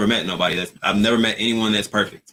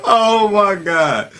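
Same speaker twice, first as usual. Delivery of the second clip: much higher, slow, breathless through real laughter; laughing; 11 semitones higher, 6 dB louder, much slower than usual. Real, not acted. Host still cracking up at Vern's look, laughing through it. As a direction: barely get the words out for laughing. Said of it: "Oh, I'm in tears".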